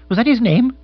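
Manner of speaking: high, loud surprised query; agitated lord taken aback; startled question. Acted. Agitated Bill reacts to the name.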